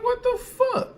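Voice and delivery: shocked outburst. Retold or imitated. Trucker recalling his overheating truck on the mountain, high shocked squeal. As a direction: re-enact the jolt of disbelief. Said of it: "And I'm like".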